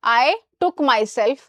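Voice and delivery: very slow, clearly spaced words; slow wrong example. Shown as a demonstration. Teacher slowly reads a wrong sentence to students. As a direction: read the error out slowly for the class. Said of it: "गलत।".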